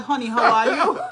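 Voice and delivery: mock honey greeting; mocking sweet greeting. Shown as a demonstration. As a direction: overplay the sweetness for a laugh. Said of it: "You may not always get to start".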